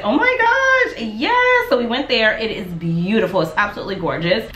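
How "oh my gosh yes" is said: high, loud squeal of delight; thrilled squeal. Retold or imitated. Hearing a favourite restaurant opened nearby, squealing with delight. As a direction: squeal it like the best news ever. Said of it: "I was like".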